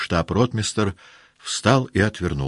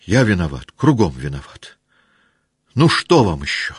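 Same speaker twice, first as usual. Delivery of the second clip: louder, welling-up confession; tearful outburst; 2 semitones higher, 6 dB louder than usual. Acted. Rostov confessing to the officers, close to tears. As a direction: admit guilt close to tears. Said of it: "Слёзы стояли у него в глазах".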